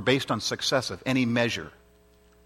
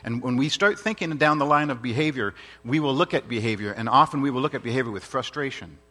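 normal talking voice

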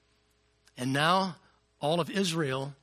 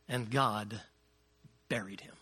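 hushed reverence; 6 semitones lower, 6 dB quieter than usual. Real, not acted. Reverent scripture quote.

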